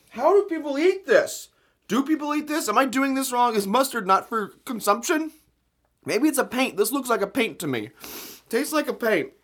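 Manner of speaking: can't believe anyone eats this; incredulous. Real, not acted. Disbelief at the taste.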